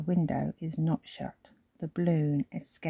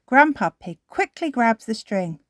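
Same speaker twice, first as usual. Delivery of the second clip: usual speaking voice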